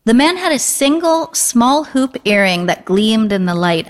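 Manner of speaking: usual speaking voice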